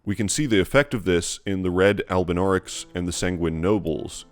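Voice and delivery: ordinary talk, nothing marked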